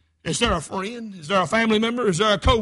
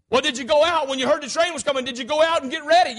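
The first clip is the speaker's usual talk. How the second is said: higher, louder pressing questions; courtroom questioning; 6 semitones higher, 6 dB louder than usual. Acted. Lawyer questioning the crossing watchman on trial, pressing questioning. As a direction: press the witness for an answer.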